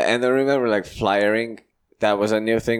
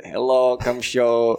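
put-on weird-smile Estonian accent; 3 semitones higher, slower than usual. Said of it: "with that weird smile and said".

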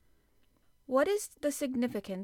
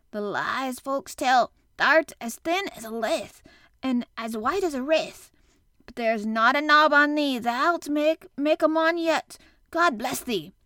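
put-on loud Yorkshire old man, building higher; bursting forth; 4 semitones higher, 7 dB louder than usual. A narrator's reading. Old gardener overcome at boy standing, bursting forth. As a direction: pour it out with rising feeling. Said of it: "he burst forth".

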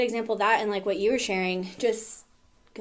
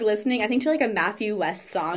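usual speaking voice

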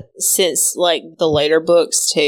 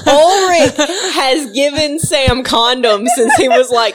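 laughing; 8 semitones higher, 5 dB louder than usual. Real, not acted. Laughs while telling her theory.

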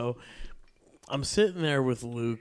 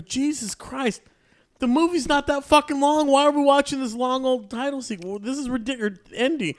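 high, loud, disbelieving complaint; exasperated rant; 10 semitones higher, 4 dB louder than usual. Retold or imitated. Complaining to Luke about endless film credits, exasperated. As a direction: rant like the credits will never end. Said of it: "I go".

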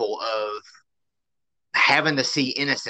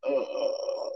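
weary groan; 9 dB quieter than usual. Retold or imitated.

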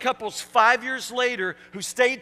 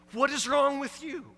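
ordinary talk, nothing marked

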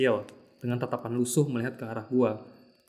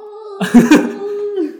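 loud high laugh; 10 dB louder than usual. Real, not acted. Laughs at late reaction.

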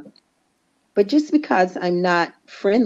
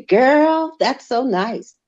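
exaggerated slang take, high on "Girl"; mock slang; 6 semitones higher, much slower than usual. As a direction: imitate someone putting slang on it, pitched up. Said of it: "Don't try to put a slang on it".

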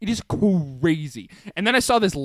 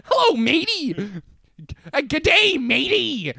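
wannabe pirate; 9 semitones higher than usual. Pretend. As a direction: ham up a pirate greeting. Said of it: "wannabe pirate".

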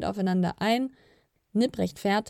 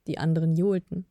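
ordinary delivery, nothing marked

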